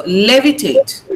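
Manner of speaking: "levitate" said slowly, stressed on the first syllable; "levitate" with a strong opening syllable. Shown as a demonstration. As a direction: lean on the LE of "levitate". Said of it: "The stress is on lay".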